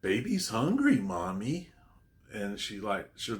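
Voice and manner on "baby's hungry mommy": high-pitched creepy-child imitation, loud at the start; put-on babyish voice, pitched way up; childish baby voice. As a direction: mimic a possessed little one in a high baby voice. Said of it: "childish baby voice".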